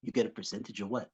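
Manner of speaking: low, quiet deadpan challenge; quiet menacing question. Retold or imitated. Wife questioning husband's cut, quiet and cold. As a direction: ask it coldly and quietly. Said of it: "And she goes".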